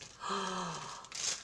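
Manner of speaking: long, soft intake of breath; real gasp of delight; moved gasp. Real, not acted. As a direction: gasp softly in wonder. Moved by a gift.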